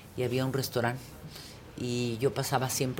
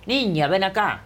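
imperious summons; 2 semitones higher than usual. Retold or imitated. Pita calls over the girl who pays her drinks, imperious. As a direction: summon someone over like a grande dame.

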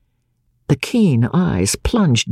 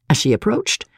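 ordinary delivery

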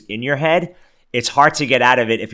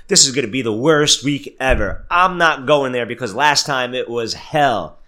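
louder and higher, a hammed-up doom-and-gloom whine; mocking overthinker; 5 semitones higher, 4 dB louder than usual. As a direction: play your own gloomy worrier for laughs, pushed up and loud. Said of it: "I'm making fun of myself".

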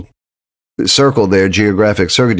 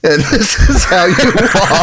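lightly mocked celebrity PSA voice, higher; Brad Pitt; 8 semitones higher than usual. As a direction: play a mock celebrity spokesman, pitched up. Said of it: "I'm Brad Pitt".

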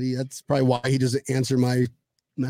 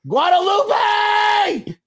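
long high drawn-out yell; shouting wife's name; 6 dB louder, much slower than usual. Pretend. Husband yelling his wife's name from the studio. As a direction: bellow her name across the house. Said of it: "eleven years marriage, you can do this".

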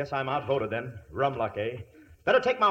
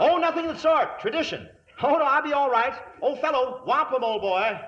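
high, louder plummy British protest; hammed-up posh English; 10 semitones higher, 4 dB louder than usual. Fake earl insisting he'll ride, high posh British. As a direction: overplay the brave English lord.

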